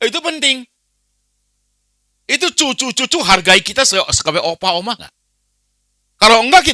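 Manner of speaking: loud and high, driving every line home; preaching at full force. Acted. Preacher warns the congregation that useless salt is trampled, loud and high. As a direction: hammer the warning at the congregation.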